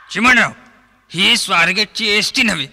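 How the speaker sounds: comic actor yelling at his co-star on the plane; mock shouting. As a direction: bark it at Chimanrao like a bus conductor. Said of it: "ओरडू नका निळू भाऊ".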